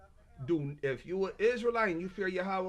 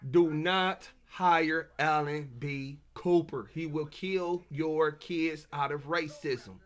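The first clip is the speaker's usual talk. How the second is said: solemn warning; 2 semitones lower, slower than usual. Real, not acted. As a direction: spell it out slowly so nobody forgets. Warning viewers, slow and deliberate.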